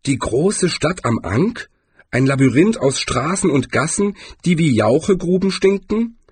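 high, incredulous questioning with rising ends; dubious shopkeeper. A narrator's reading. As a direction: lift each phrase into a doubtful question. Voices the shopkeeper questioning the destination.

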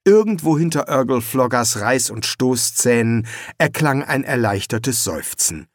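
usual speaking voice

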